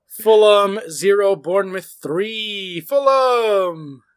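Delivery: slow, loud score call; drawn-out score read. Real, not acted. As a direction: stretch the names out, then groan. Reading out a bad result.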